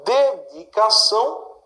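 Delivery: "Dedicação" split slowly, ending on a strong "ção"; slow, measured "Dedicação" with a weighted last syllable. Shown as a demonstration. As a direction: break "Dedicação" into deliberate syllables. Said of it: "sílaba mais forte é essa".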